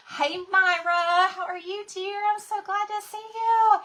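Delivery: high, gushing hello; delighted greeting. Real, not acted. Host welcoming a viewer to the live, delighted greeting. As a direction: squeal a loud, high-pitched welcome, thrilled to see them.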